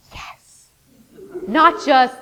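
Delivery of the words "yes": hushed whispered yes. Shown as a demonstration. Teacher confirming to the class, still whispering. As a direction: keep it to a bare whisper.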